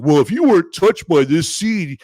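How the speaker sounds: slow, preachy mock outrage; mock dramatic "you don't have a soul"; mocking online commenters. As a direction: sneer it like a self-righteous commenter. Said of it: "people ... say".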